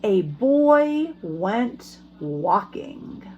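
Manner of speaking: slow, lively storybook reading; reading to children. A narrator's reading. Teacher reading a picture book to her class, lively storytelling.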